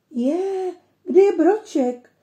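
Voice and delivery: high, loud alarmed cry; suddenly notice the puppy is gone; a little girl startled; startled fright. A narrator's reading. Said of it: "polekala se Alenka".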